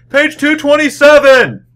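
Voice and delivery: screamed. Retold or imitated. Guy six rows back shouting the page, loud high scream. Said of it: "six rows back screams".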